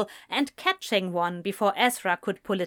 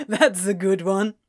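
loud, amused disbelief; laughing; 5 dB louder than usual. A narrator's reading. Friend takes the wedding news for a joke, laughing. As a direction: say it just out of a laugh. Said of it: "began to laugh".

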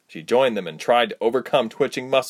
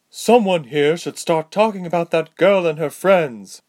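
slow, loud, higher female soldier order; commanding; 8 semitones higher, 5 dB louder than usual. A narrator's reading. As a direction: bark the order like an officer. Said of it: "the female soldier commanded".

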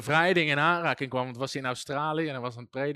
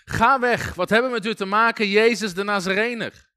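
a louder, raised cry of a panicking demon; a demon yelling at Jezus in the synagogue, a loud raised cry; demon shouting; 3 semitones higher, 6 dB louder than usual. Retold or imitated. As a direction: shout him away in alarm. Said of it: "Die beginnen te schreeuwen".

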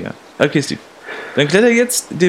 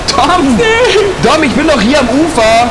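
loud, high-pitched calling out; desperate rescue shout; 9 semitones higher, 4 dB louder, slower than usual. Acted. Man shouting to his friend in the river, desperate calls. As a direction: yell over the water, stretching the words.

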